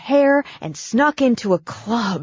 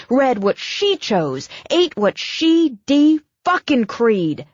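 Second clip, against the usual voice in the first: louder, heated tirade rising high; building rant; 6 semitones higher, 4 dB louder than usual. Acted. Brooke railing at Abigail's cold mother, heated and loud. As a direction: tear into the mother, voice climbing.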